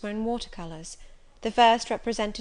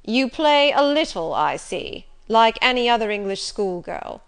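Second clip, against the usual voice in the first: Rochester, commanding; 2 semitones higher, 8 dB louder than usual. Acted. Rochester judges her playing.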